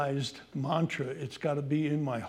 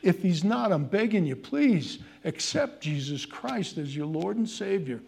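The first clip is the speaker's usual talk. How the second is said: ordinary talk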